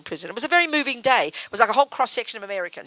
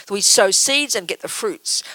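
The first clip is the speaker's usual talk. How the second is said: ordinary delivery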